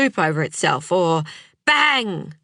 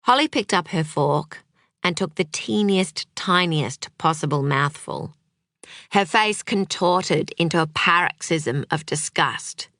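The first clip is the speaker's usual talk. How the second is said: ordinary delivery, nothing marked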